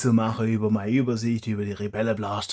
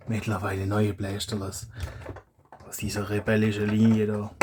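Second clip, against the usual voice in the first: ordinary talk, nothing marked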